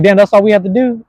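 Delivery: loud, high-pitched mock amazement; retold incredulous outburst. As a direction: cry it out in playful disbelief. Voicing friends' shared amazed realization.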